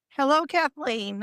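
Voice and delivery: usual speaking voice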